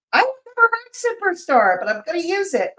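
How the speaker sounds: high, loud burst of delight; thrilled by the nickname, voice shooting up. Real, not acted. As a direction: squeal with glee at a new word. Delighted by a chat term.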